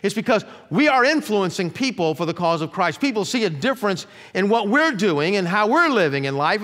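ordinary delivery

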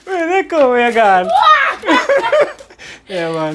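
shrieking with laughter; loud surprised laughing; laughing hard. Real, not acted. Trick surprise, laughing loudly.

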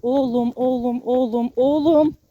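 calling the dog, building from firm to loud; fed-up calling. Real, not acted. Owner losing patience with her pulling dog, building to a loud call. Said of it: "oğlum demekten bıktım".

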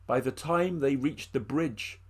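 plain reading voice

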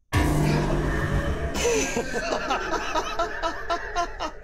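high burst of laughter; loud laugh. Real, not acted. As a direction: cackle at the prank. Laughs at game jumpscare.

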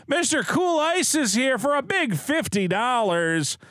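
high, booming announcer read. Acted. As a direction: hype it like a host. Reading big super chat.

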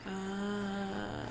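normal talking voice

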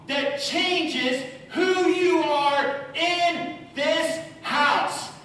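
very slow, high, loud declaration; impassioned preaching. Acted. Pastor declaring love to his son at the climax, passionate. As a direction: pour everything into each word.

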